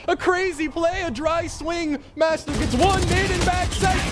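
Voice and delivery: high, fast hype call; esports caster. Real, not acted. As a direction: call the play with rising hype. Hyped play-by-play call.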